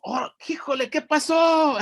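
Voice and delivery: high exclamation, rising to loud; excited. A narrator's reading. Lords startled by the glorious sound, excited. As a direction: burst out, amazed and thrilled. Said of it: "everybody gets all excited".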